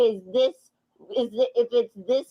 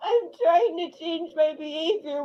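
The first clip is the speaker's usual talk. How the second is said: high, crying plea; tearful pleading; 4 semitones higher than usual. Real, not acted. Woman pleading with her partner, crying. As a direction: plead through real tears.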